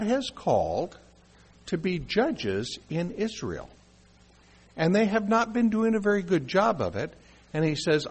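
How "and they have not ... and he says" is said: ordinary delivery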